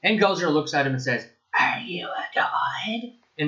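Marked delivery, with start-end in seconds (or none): 1.6-3.1 slow, whispered sneer, higher in pitch; imitating a haughty, whispered challenge; derisive. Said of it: "with the exact same derision".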